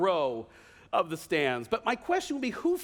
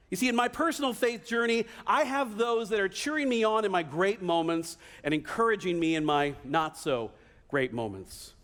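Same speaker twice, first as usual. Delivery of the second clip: ordinary talk, nothing marked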